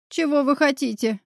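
ordinary delivery

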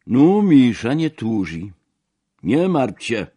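loud, high shout across the table; big, high-pitched holler in Russian. A narrator's reading. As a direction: call it out boisterously to someone. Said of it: "krzyknął w moją stronę".

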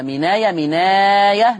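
مناية said twice with the long vowel stretched to a longer count each time; slow demo of the long vowel in مناية; lengthened vowel demo. Shown as a demonstration. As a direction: stretch the madd in مناية slowly, showing the lengths. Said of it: "نقل مع ثلاثة البدل، اتنين وأربعة وستة".

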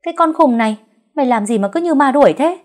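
high, loud irritated shout; scolding yell. A narrator's reading. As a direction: bawl out the frantic servant. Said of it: "chạy ra hét lên".

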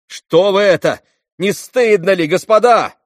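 high, loud, rising alarm; shouted protest. A narrator's reading. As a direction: cry out to stop the boys. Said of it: "закричал Алёша". (возмущённый крик)

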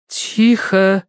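high, drawn hush order; commanding. A narrator's reading. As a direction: order silence as a medium. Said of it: "велела мадам Эритоцкая".

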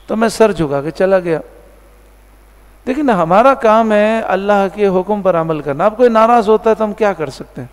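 normal talking voice

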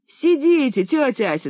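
put-on high, loud boy's cry; boy shouting to the neighbour to stay. A narrator's reading. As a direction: call out eagerly to stop her leaving. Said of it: "закричал Ромка".

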